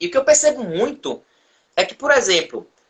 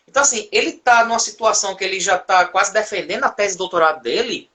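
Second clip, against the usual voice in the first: usual speaking voice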